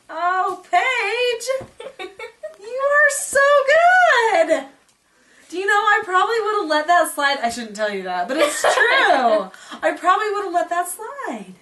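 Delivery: high, loud, laughing with delight. Real, not acted. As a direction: gush with surprised joy. Delighted surprise at honesty.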